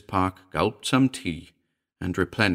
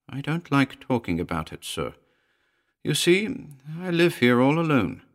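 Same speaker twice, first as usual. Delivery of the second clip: higher, lightly voiced housekeeper; a touch of a reluctant servant, pitched up; uneasy housekeeper; 7 semitones higher than usual. A narrator's reading. Housekeeper living alone confides in a visitor, mildly uneasy.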